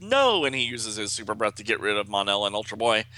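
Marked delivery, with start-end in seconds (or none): 0.0-0.4 high, loud acted "no"; emphatic comic-book cry; firm refusal. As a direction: shout down the offer.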